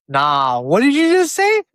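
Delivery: mock astonished friend, voice shooting up; stunned reaction to hearing Cuban slang; surprised quote.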